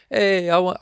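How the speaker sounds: hammed-up Italian accent from a lively restaurant guest; mock Italian accent. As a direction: imitate a cheerful Italian diner, badly, in an Italian accent. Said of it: "I can't do Italian accent".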